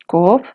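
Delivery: koob said with a rising pitch. Shown as a demonstration. Teacher sounding out an unknown word's tone, rising. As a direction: let koob slide up. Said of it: "quiero saber qué tono tiene".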